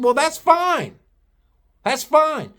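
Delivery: high-pitched, brush-off retort; dismissive retort. Retold or imitated. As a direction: wave the complaint away in a high voice. Retold retort to threatening customer.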